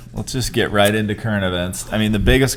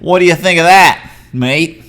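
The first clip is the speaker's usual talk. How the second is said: loud, high, cocky mock-Aussie; Australian accent; 8 semitones higher, 8 dB louder than usual. Shown as a demonstration. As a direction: challenge them in a broad fake Australian accent. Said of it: "let me do it in Australian".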